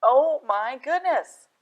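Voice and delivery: slow amazed exclamation; astonished. Acted. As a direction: really savour the shock. Repeated louder, slower.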